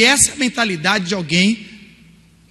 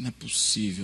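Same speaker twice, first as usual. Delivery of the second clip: much lower, hushed stunned; stunned disbelief; 9 semitones lower, 12 dB quieter, faster than usual. Retold or imitated. Reading news of Bin Laden's death, hushed shock. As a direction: breathe out in shock.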